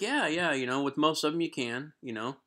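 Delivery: high, agreeable bluff, acted; playing it off. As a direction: play along as if you understood. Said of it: "playing it off like I understood".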